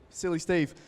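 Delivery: ordinary talk, nothing marked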